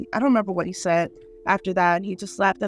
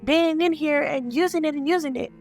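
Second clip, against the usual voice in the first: retold angry rant, pitched higher; going off; 7 semitones higher than usual. Patient confronts brother over using her stuff, going off. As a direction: scold in a high, indignant run. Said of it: "I go off on my brother".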